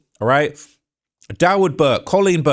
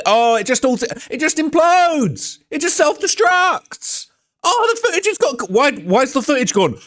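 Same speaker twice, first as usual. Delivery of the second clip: much higher, slow, drawn-out sneer; sarcastic mock; 11 semitones higher than usual. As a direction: stretch the words out like a sarcastic cartoon. Sarcastic mimicry of the excuse.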